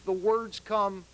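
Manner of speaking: usual speaking voice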